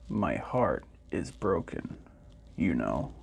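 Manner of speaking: deep, quiet, forlorn robot; sad robot. A narrator's reading. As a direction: speak low and slow like a lonely old machine. Robot speaks in the story.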